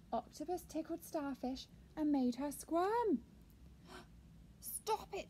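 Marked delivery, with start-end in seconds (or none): 4.6-5.3 high little squeak. A narrator's reading. Starfish objecting to being tickled, high squeak. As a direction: squeak it out after a gasp. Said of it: "she squeaked".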